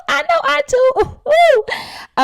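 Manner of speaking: very high, loud gleeful whoop; excited squeal. Real, not acted. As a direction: whoop with delight, pitch shooting way up. Hyped about the shimmy.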